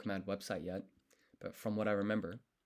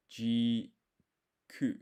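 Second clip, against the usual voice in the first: ordinary delivery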